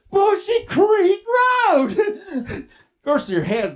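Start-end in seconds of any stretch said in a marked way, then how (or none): 0.1-2.0 slow, loud, high wail; comic panicked wail. Acted. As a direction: stretch each word in mock horror. Panicked repeat of road's name.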